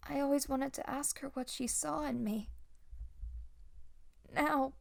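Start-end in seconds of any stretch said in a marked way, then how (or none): none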